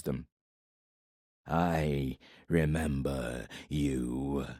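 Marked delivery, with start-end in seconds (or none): 1.5-4.5 low, very slow, heavy voice of a giant warlord; menacing warlord from the shadows. Acted. Giant warlord speaks to Corbin from the shadows, low and slow. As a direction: let each word drop slow and low like a giant's threat. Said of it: "Ildair said".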